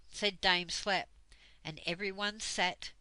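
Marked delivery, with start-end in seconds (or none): none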